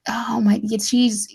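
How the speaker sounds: ordinary delivery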